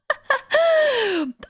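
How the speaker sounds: high burst of laughter. Real, not acted. Laugh after rant.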